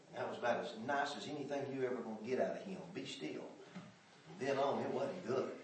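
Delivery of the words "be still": whispered, stern hush; whispered "be still". Retold or imitated. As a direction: shush them under your breath. Grandpa's stern hush echoed.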